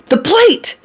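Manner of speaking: very high, loud exasperated yell; frantic high-pitched shout; scream. A narrator's reading. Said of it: "he screams".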